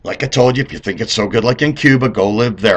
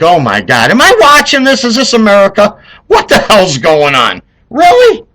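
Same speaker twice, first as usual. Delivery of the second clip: rising, louder cries of disbelief at the news; stunned viewer who can't believe the TV; shocked disbelief; 6 semitones higher, 4 dB louder than usual. Retold or imitated. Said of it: "and I'm like".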